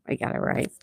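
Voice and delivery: a private little victory whisper; whispered gloat. Real, not acted. Host whispering a smug aside to the chat, whispered. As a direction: whisper it to yourself, pleased. Quiet gloat after answer.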